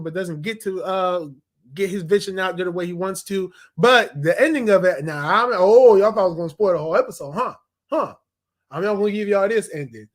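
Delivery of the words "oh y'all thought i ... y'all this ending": teasing. Real, not acted. Teasing the viewers.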